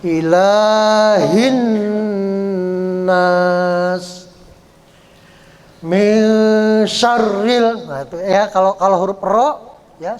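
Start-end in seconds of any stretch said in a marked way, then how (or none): 0.0-4.2 slow drawn-out tajwid chant; Quran recitation. Shown as a demonstration. Teacher reciting verse to students, slow chant. 5.8-7.9 "Min syarril" chanted slowly, the doubled r struck sharply. Shown as a demonstration. Teacher reciting to students, doubled r struck short. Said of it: "Syarril, ya".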